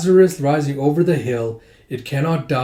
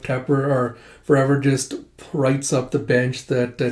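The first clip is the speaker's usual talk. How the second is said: normal talking voice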